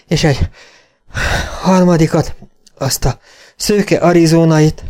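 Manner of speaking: high, halting account between breaths; winded, struggling through the story; panting. A narrator's reading. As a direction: gasp out the report in broken phrases. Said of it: "lihegte a karvajórú kóbor Jenny".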